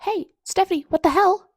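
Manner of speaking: high, loud call to the author; outraged shout. Real, not acted. Reader shouting at the author over a painful chapter, outraged. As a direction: yell her name in disbelief.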